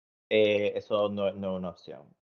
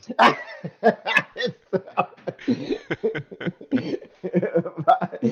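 high, giggling fit of laughter; long real laugh; 9 semitones higher than usual. Real, not acted. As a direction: keep laughing helplessly. Laughs at own joke. (ataque de risa agudo)

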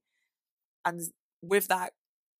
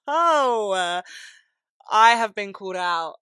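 mock caught out; 4 semitones higher, 10 dB louder, slower than usual. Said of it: "and I was like".

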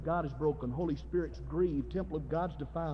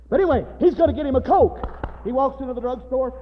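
raised, energetic pulpit storytelling; animated preaching; 8 semitones higher, 9 dB louder than usual. Real, not acted. Preacher telling of a lad and the drugstore, animated preaching. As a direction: tell it with loud, high bursts of preacher's zeal.

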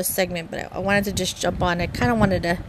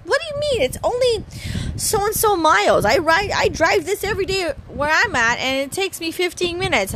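much higher, disbelieving tourist voice; mock incredulous tourist; 10 semitones higher than usual. Concierge imitating a doubtful mainland caller, high and disbelieving. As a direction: mimic a visitor sure the drive is short. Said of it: "They're like".

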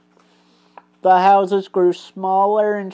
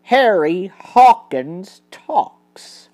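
ordinary delivery

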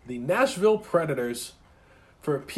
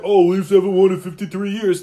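loud taunting fan voice; mocking rival fans; 3 semitones higher, 7 dB louder than usual. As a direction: mimic a smug heckler. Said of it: "people are always like".

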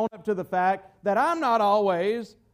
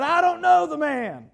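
emphatic, higher-pitched mock outburst; retold swearing denial; 5 semitones higher, 4 dB louder than usual. Preacher retelling Peter cursing as he denied the Lord, mock-vehement denial. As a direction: act out a heated denial, only half in earnest. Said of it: "He cursed and swore".

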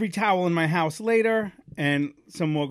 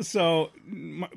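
ordinary talk

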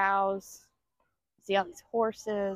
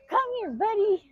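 excited call; 12 semitones higher than usual. Real, not acted. Calling the goat excitedly.

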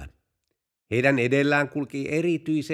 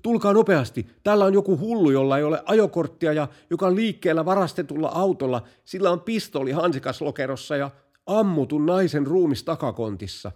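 high, loud, panicky call for backup; horrified; 6 semitones higher, 4 dB louder than usual. Acted. Policeman calling for reinforcements about a madman, horrified. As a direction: report it in alarm, pitched up and urgent. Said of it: "Poliisi kauhistui".

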